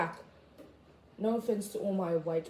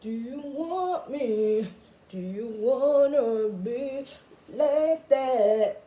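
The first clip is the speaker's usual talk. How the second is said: slow sticker voice; 3 semitones higher, 5 dB louder, much slower than usual. Retold or imitated. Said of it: "it was like".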